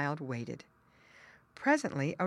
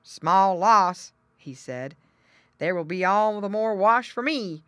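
louder, dismissive pig voice; grunting pig; 3 semitones higher, 8 dB louder than usual. Acted. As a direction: shrug it off like a greedy pig. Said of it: "The pig brother grunted".

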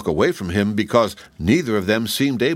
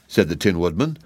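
plain reading voice

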